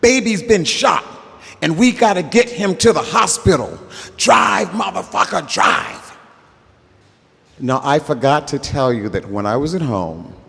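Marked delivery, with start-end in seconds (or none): 0.0-6.2 frantic shout. Retold or imitated. G-Man yelling at the driver to get moving, high frantic shout. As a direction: bark the orders like a man with a bleeding friend.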